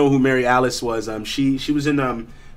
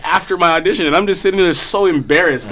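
normal talking voice

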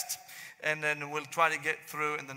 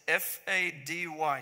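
spelling out slowly; slower than usual. Retold or imitated. Spelling his name for the waitress, "F A D Y" slowly, letter by letter. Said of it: "I said".

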